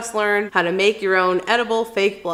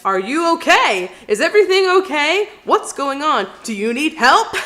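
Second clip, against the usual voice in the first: concerned stranger; 6 semitones higher, 4 dB louder than usual. Retold or imitated. Concerned stranger at gas station.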